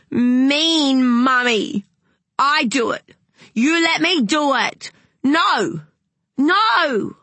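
put-on high, loud, drawn-out toddler rage; a furious little boy wailing at mummy; child screaming at his mother over the bath plug; tantrum. A narrator's reading.

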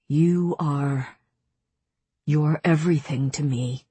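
mildly choked-up male lover; halting, thick with emotion; thick-voiced. A narrator's reading. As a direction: say it thickly, with understated feeling. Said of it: "he said thickly".

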